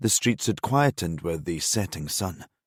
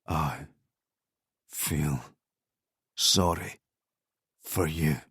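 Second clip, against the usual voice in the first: softer, lower, drugged murmur; murmured to his captor, softer and lower; weak murmur; 4 semitones lower, 6 dB quieter, slower than usual. Acted. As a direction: barely get the words out, fading. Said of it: "Grant murmured".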